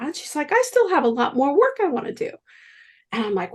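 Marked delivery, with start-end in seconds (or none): 0.5-2.3 higher, fast nun's plea; protesting nun. Retold or imitated. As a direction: rush it like an earnest nun. Nun protesting her prognosis.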